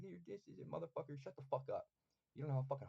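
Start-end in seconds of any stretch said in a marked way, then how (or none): 0.0-0.7 high, soft jeering imitation; mocking trash-talker. Critics talking shit about his push-up form, mocking high voice. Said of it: "they're sitting there talking shit like". 0.7-1.9 high-pitched, fed-up comeback; indignant retort. Acted. Snapping back at people criticizing his form, indignant retort. Acted retort to trash-talkers.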